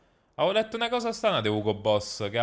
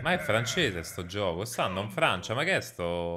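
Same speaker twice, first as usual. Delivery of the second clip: normal talking voice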